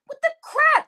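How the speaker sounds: shocked, indignant outburst; outraged disbelief. Real, not acted. Ranting at Sister T, high shocked outburst. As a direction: pitch jumps high on the crap.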